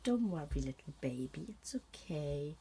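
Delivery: soft hushed coo fading to a whisper; mock the parent cooing at the baby; soothing parent. Imitating a parent whispering to a crying baby. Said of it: "all you're doing is".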